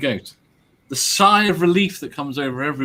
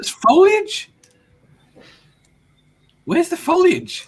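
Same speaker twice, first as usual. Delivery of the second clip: high incredulous squeak; mock surprise; 12 semitones higher, slower than usual. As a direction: act stunned by the foliage. Mock disbelief at hint.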